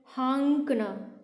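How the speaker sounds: हाँकना slowly, with an ng sound after haa; very slow हाँकना with a nasal ng; nasal demo. Shown as a demonstration. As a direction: stretch हाँकना, haang-kna. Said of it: "अब वो अंग वाली आवाज़ आ रही है".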